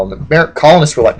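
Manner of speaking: normal talking voice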